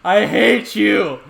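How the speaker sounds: high, drawn-out enraged yell; loud, exasperated outburst; furious shout. Real, not acted. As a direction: shout it slowly at full volume. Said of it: "I am so mad".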